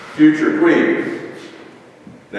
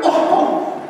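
mock excitement. Mimicking the excited hitchhiker.

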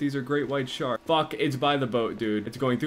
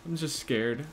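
ordinary talk

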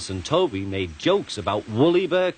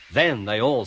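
ordinary delivery, nothing marked

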